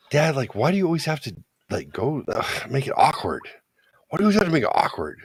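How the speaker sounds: high-pitched mock teen complaint; acted-out exasperated kid; exasperated daughter. Daughter complains to dad in story.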